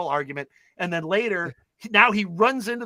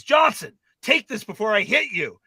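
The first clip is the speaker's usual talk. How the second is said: acted loud threat, raised pitch; Doug; 4 semitones higher, 4 dB louder than usual. Jock handing the boy a condom, acted loud threat. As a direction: bark a mock warning, loudest on the name.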